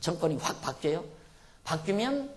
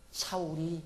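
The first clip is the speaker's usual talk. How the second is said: normal talking voice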